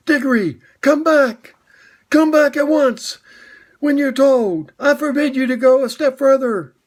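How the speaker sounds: high, loud, reedy calling from afar; half-hearted shout. Acted. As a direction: shout after him, but hold back from full voice. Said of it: "shouting, but by no means at the top of his voice".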